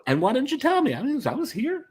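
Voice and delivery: high-pitched put-on complaint; mock hurt. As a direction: whine playfully at being left out. Mock hurt at not being told.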